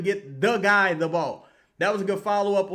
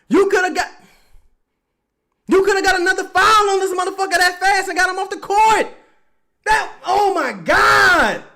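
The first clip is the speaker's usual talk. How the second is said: screaming at the screen, much higher and louder; furious shout; 14 semitones higher, 10 dB louder than usual. Real, not acted. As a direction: yell in real outrage at the player. Fan furious at a dumb play.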